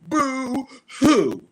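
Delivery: high, loud mocking crybaby "Boo hoo". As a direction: taunt a whiner.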